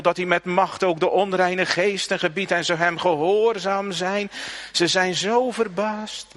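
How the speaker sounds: preek het luid en hoger uit; fervent preaching. Acted. Preacher holds full force. (vurig preken, luider en hoger)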